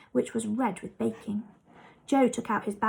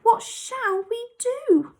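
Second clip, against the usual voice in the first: much higher, slow worried plea; dismayed; 11 semitones higher, slower than usual. A narrator's reading. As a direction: ask helplessly in dismay. Bessie looked in dismay.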